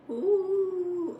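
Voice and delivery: high ghostly ooh. Retold or imitated. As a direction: play the ghost for the kid. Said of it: "she'll go like".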